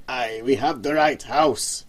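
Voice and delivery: slow cackling with a hint of Scottish; a sly old man's Scottish-tinged cackle; a hunched old man cackles to the boss; slight Scottish cackle. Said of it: "cackled the hunched man ... Scottish accent".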